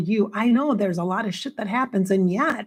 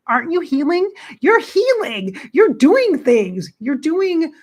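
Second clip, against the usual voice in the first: much higher, louder encouraging counselor; counselor's voice; 8 semitones higher, 5 dB louder, slower than usual. Retold or imitated. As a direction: reassure her like a kind therapist. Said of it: "And she's like".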